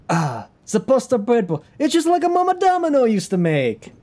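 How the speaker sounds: put-on high-pitched hammy Italian accent; Jim Gaffigan's Italian bit. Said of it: "Jim Gaffigan's making fun of".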